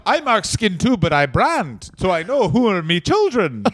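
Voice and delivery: put-on light comic Irish accent; mock Irish accent. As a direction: brag in a hammy Irish lilt. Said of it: "finally arrived Irish".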